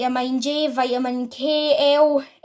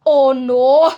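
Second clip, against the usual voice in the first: louder alarmed outcry; dismayed cry; 9 dB louder than usual. Acted. Mother cries out at the thought of long toes, loud and alarmed. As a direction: cry out in horror. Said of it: "cried Missus Teavee".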